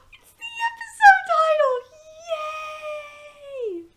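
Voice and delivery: very high, loud excited squeal; thrilled squeal. Real, not acted. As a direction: shriek with delight at the discovery. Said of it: "And I was like, gasps".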